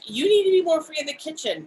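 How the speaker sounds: high, loud imitation of an ex; emphatic retold urging, pitched well up; Usman. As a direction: push it up high and insistent. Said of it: "he was Pakistani, and he was like".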